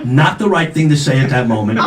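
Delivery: ordinary talk, nothing marked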